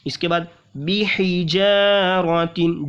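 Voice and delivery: बिहिजारतिन with हा from mid-throat. Shown as a demonstration. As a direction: recite with a throaty हा. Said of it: "हा को निकालेंगे हलक के दरमियान से".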